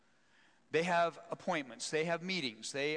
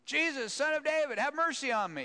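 high, loud cry for mercy; desperate yell; 12 semitones higher, 6 dB louder than usual. Acted. Blind man yelling to passing Jesus, loud plea. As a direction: yell out from the roadside. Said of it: "starts yelling".

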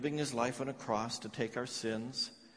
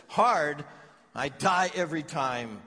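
louder and higher, a cry of pain; anguished cry; 4 semitones higher, 8 dB louder than usual. Acted. Grieving mother crying out to a fellow parent in anguish. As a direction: burst out with the pain of it.

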